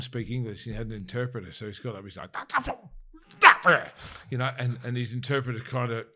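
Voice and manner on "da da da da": much higher, loud nonsense syllables; mock angry gibberish. The Chinese delegate ranting at the room, mock angry gibberish. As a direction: ham up a foreign guy ranting. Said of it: "this Chinese guy was kinda angry".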